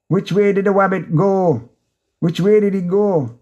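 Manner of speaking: a quick high mock of the cartoon rabbit hunter; lightly voiced hunter searching for the rabbit.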